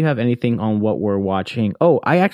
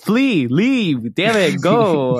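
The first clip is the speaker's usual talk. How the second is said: higher, hammed-up urgent command to run; mock urgent shout; 7 semitones higher, much slower than usual. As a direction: shoo them away in mock panic. Vampire urging victim to flee.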